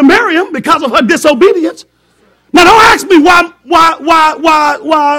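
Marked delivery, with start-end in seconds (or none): 2.5-5.2 loud, high repeated why; preaching. Acted. Preacher shouting rhetorical whys to congregation. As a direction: hammer the whys at the church.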